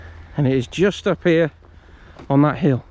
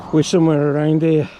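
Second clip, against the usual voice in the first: ordinary talk, nothing marked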